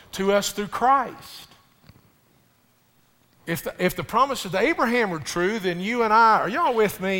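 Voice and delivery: a pastor preaching hard about the cross; preaching at full force. Acted. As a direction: drive the point home to the congregation.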